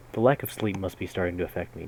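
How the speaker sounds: ordinary talk, nothing marked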